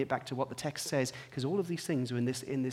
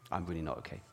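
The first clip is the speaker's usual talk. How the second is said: much lower, softer, deflated; low, quiet despair; 9 semitones lower, 8 dB quieter than usual. Retold or imitated. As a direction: let it sink, barely audible. Retelling own low answer on phone.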